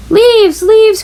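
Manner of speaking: high, eager cry of delight; excited cry. A narrator's reading. Froggy cries out at the falling leaves, excited. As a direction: call out like a kid who can't wait. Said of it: "cried Froggy".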